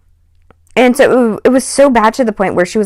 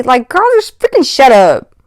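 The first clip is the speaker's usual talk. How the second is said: annoyed; 4 semitones higher than usual. Real, not acted. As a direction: snap at her, fed up. Speaker venting at the girl.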